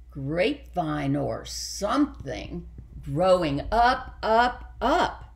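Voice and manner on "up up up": each up climbing higher in pitch; rising higher. A narrator's reading. Vine growing higher.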